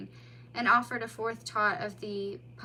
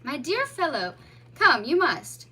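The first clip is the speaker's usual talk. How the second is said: louder, higher, coaxing; gently urging mole; 5 semitones higher, 8 dB louder than usual. A narrator's reading. Mole to the frightened otter, urging. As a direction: coax him inside.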